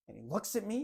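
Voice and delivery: ordinary talk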